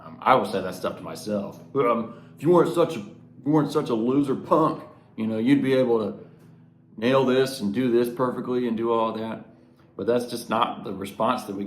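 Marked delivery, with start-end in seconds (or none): 1.8-9.4 higher, louder, fast taunting voice; mocking self-talk sneering loser punk; harsh inner critic. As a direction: taunt yourself like a schoolyard bully. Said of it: "the voice in my own mind".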